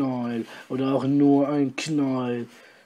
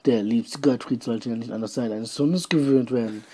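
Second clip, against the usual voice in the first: usual speaking voice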